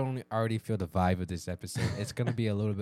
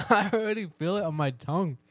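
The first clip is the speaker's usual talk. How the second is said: laughing; 8 semitones higher, 7 dB louder than usual. Real, not acted. Reacting to hot wings.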